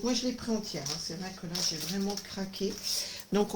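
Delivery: ordinary delivery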